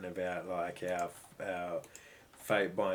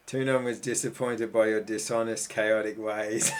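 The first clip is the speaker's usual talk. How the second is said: loud, breaking into laughter; loud amused read-out, slowing into laughter; real amusement; 7 dB louder than usual. Real, not acted. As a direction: read it out loudly, tipping into a laugh. Delighted by the game's result.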